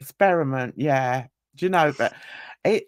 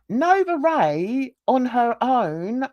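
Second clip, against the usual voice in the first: ordinary talk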